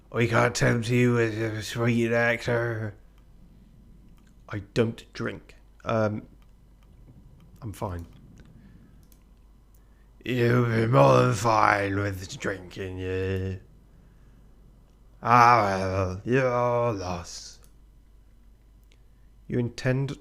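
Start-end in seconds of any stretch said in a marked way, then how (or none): 0.1-2.9 put-on drunk pirate. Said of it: "Drunk Irish?". 10.2-17.6 put-on drunk pirate. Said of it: "Drunk pirate?".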